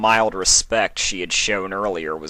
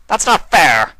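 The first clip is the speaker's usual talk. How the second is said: higher, louder protest; a hurt, mock-offended cry; wounded Discord; 9 semitones higher, 10 dB louder than usual. A narrator's reading. Discord answers Sombra's accusation, higher and louder. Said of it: "Discord replied with a claw in his chest".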